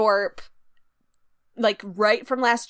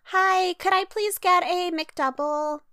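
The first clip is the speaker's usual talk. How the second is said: high, slow, sugary polite voice; customer service voice; 6 semitones higher, slower than usual. Retold or imitated. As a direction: put on a sweet drive-thru voice. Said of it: "when you go to a drive-thru and you're like".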